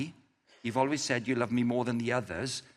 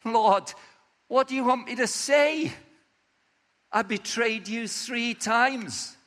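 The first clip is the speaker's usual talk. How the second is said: pleading Peter; 8 semitones higher, 5 dB louder than usual. Acted. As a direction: lay it all out, begging. Peter pleading his case.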